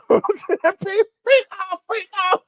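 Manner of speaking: high, leering singsong; mock pervert cooing to a pet bird; creepy guy voice. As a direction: ham up a creep luring a parrot. Voicing creep again.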